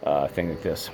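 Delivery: ordinary talk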